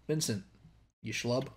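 normal talking voice

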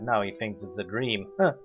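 ordinary delivery

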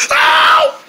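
high sharp cry of pain; pained yelp. Acted. Toy hit in the eyes by spray, pained yelp.

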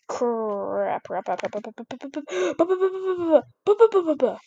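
frantic mounting panic; panicked cursing. Real, not acted. Gamer to viewers, muttering crap then yelling it as balloons get close.

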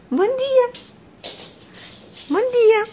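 put-on high sing-song baby talk; high cooing greeting to the baby. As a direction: coo good morning to a baby.

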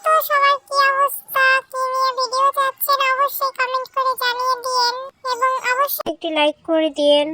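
ordinary delivery

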